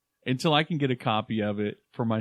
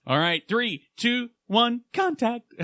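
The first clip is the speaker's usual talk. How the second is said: TV intro announcer; 10 semitones higher, slower than usual. Retold or imitated. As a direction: voice climbing higher on each number up to contact. Imitating the show's intro.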